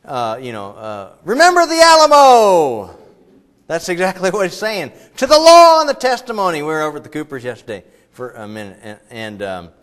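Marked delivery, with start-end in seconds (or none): 1.2-3.0 loud, high, slow battle cry; rally the troops. Retold or imitated. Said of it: "someone standing up and going". 5.2-6.6 loud, high proclamation; rallying cry. Acted.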